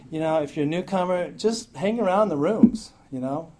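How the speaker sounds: ordinary talk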